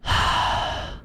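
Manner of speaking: long, voiceless exhale; deep sigh. A narrator's reading. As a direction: let out a heavy sigh. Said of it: "She sighed deeply".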